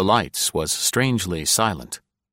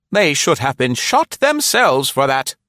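higher, louder haughty matron; indignant sniff; 7 semitones higher, 6 dB louder than usual. A narrator's reading. Nora scorning the dog's killers, indignant sniff. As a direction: sniff it out with lofty disdain. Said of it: "Nora sniffed".

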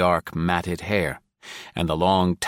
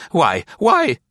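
high, louder stammering outrage; choked sputter; 10 semitones higher, 5 dB louder than usual. A narrator's reading. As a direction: sputter in disbelief. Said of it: "Nora choked".

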